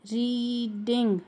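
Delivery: "reading" with a stretched ee before ding; long E. Shown as a demonstration. Teacher showing the long sound, drawn-out E. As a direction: draw out the first vowel of "reading". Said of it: "long sound of".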